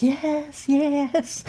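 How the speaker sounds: high, eager yes; excited sister. Retold or imitated. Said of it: "She goes".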